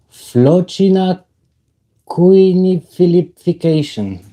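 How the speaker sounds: slow, high, careful try at Flocina quinification; fumbled tongue-twister. Real, not acted. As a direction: sound out Flocina quinification syllable by syllable. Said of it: "I will get that right".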